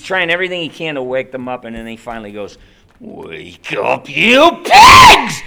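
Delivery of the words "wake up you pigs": sudden shriek, much higher and louder; breaking character scream. Acted. Ghost losing patience with sleepers, a shrieking yell. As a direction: explode out of the timid voice. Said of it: "break character".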